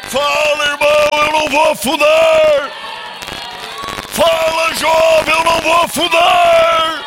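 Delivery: rally the church with a shout; full-force preaching cry of defiance; shouted declaration. Acted.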